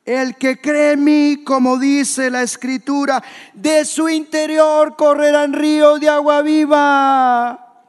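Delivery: high, loud, sustained proclamation; voz alzada. Acted. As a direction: cry the verse out over a festival crowd. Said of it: "y alzó la voz". (proclamar a gritos, alto y fuerte)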